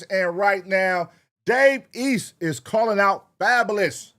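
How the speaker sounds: loud YouTube host intro; hype intro. Acted. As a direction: pump up the audience for the reaction. Channel intro, announcer read.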